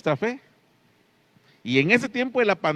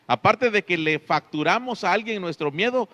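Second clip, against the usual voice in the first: ordinary talk, nothing marked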